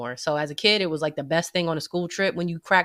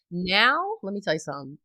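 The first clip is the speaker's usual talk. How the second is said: usual speaking voice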